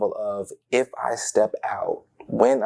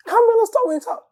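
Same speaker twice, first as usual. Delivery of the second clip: much higher and louder, squealing; excited cousin; 4 dB louder than usual. Retold or imitated. Cousin Bridget pulling him aside, excited high squeal. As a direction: gush like a thrilled cousin. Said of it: "She was like".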